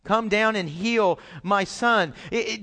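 ordinary delivery

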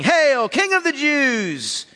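drawn-out hammed-up hail; mock yelling. A narrator's reading.

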